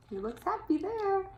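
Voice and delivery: high, soft, pleased comment; soft high delight; delighted aside. A narrator's reading. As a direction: coo it over the happy pig. Delighted read-aloud comment.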